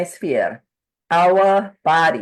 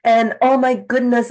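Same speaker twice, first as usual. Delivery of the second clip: high, upset retelling of her body's reaction; distressed client; 6 semitones higher than usual. Retold or imitated. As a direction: voice her panic, pitched up. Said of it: "And she said".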